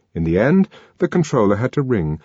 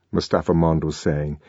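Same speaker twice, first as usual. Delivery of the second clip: ordinary delivery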